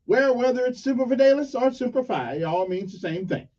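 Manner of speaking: normal talking voice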